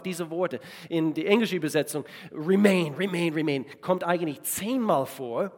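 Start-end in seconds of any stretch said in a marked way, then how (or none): none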